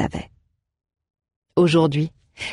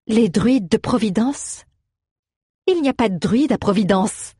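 smiling retort; 5 semitones higher than usual. A narrator's reading. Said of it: "répliqua Aléa tout sourire".

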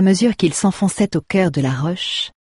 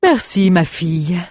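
smiling baron; 3 dB louder than usual. Acted. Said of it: "répondit Alvaro en souriant".

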